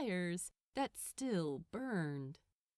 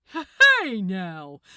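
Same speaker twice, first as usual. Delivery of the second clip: much louder "Hey now!" to stop him; loud warning; 15 dB louder than usual. Acted. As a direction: bark it as a smug warning. Gabriel fends off Crowley's lunge.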